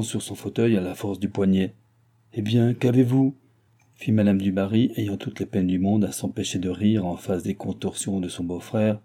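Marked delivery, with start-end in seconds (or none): none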